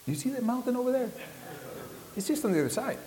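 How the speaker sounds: very high, quick villager voice; squeaky-high guide brushing off the question; imitated villager. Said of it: "And he'd say".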